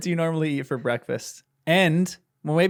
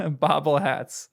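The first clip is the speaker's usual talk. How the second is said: normal talking voice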